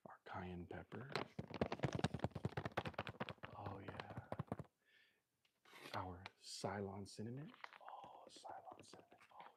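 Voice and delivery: hushed, breathy ingredient list; soft teasing whisper; ASMR whisper. Pretend. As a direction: keep whispering like an ASMR clip. Keeps the ASMR whisper going.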